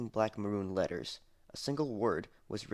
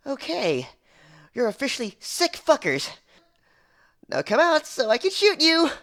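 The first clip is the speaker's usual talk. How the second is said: much higher, much louder yelled threat; furious shout; 12 dB louder than usual. Acted. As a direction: scream it down the empty hall. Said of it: "and shouted".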